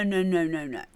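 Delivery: normal talking voice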